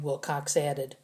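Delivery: plain reading voice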